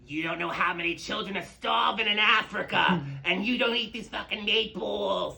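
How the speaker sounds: high, loud scolding shout; mom yelling. Retold or imitated. Brooklyn Italian mom yells at son over meatballs, loud. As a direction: yell it like an overbearing mom. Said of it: "So she'll be like".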